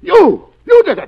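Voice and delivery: loud accusing cry; loud, accusing exclamation; shouted accusation. Acted. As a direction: hurl the accusation at him. Boland confronted, exclaims.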